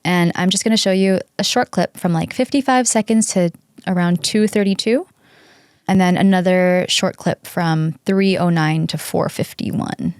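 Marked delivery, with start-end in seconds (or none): none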